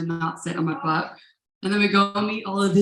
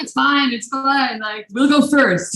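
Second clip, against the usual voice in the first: egging on; 7 semitones higher, 7 dB louder than usual. Retold or imitated. Friend talks her into jumping.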